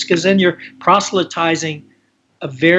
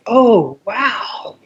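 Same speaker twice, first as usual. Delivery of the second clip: mock surprise; 7 semitones higher than usual. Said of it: "I'm going".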